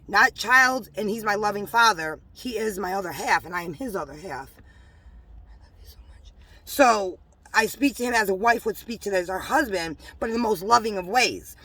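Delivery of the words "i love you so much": barely audible whispered endearment; intimate whisper. Real, not acted. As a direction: whisper it privately to your beloved. Private aside to her divine husband.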